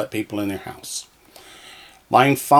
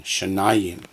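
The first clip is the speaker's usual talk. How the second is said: normal talking voice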